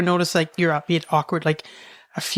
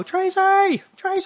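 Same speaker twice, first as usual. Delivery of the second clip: imitated distant yell; 14 semitones higher, slower than usual. Child in the barn hears mom calling, imitated high yell. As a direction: call out in mom's far-away yell. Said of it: "she's still yelling at me".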